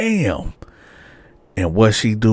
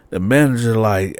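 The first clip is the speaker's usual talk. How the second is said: normal talking voice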